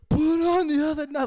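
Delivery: put-on high, loud kid's yell; yelling back. Daughter yelling back at dad from bed, mock yelling. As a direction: imitate a child hollering a demand. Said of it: "like, yelling back".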